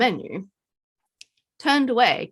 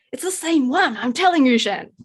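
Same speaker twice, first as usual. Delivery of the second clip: raised, higher insisting; Ned insists to Shen, louder and higher; insistent; 5 semitones higher, 5 dB louder than usual. Acted. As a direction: press the point on Shen.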